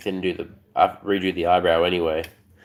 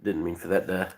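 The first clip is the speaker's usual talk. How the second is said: ordinary delivery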